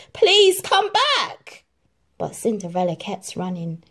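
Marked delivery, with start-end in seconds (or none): 0.1-1.6 slow, high, loud plea; pleading call. Acted. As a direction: beg her to come back, stretched out. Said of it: "the prince called after her".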